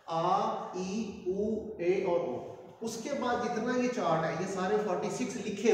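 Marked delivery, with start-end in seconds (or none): 0.1-2.9 आ ई उ ए ओ said slowly and clearly, ओ stretched long; slow model vowels for students to copy; drawn-out vowel drill. Shown as a demonstration. Teacher models Japanese vowels slowly to the class. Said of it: "ये पांच आवाज़ें हैं".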